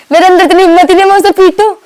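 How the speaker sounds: incredulous protest. Real, not acted. Protests she could never hit.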